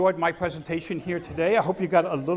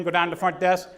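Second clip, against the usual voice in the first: ordinary talk, nothing marked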